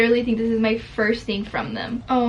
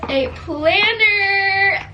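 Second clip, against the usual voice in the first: loud, drawn-out, higher exclamation; delighted; 5 semitones higher, 5 dB louder, much slower than usual. Real, not acted. Vlogger discovers a gift from her friends, delighted.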